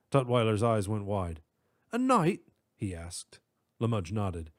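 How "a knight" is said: startled high question. Acted. Professor startled.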